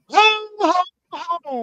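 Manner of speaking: high, hearty Santa laugh; jolly ho ho ho. Acted. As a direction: bellow it like Santa Claus. Santa intro redo.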